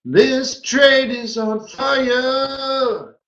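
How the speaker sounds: This trade is on fire stretched slow and high; drawn-out cheer. Real, not acted.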